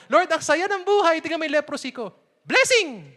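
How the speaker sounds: high, loud fake joy; mock cheerful denial. Preacher mocking Job pretending to be happy, high and loud. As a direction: force the cheer through the pain.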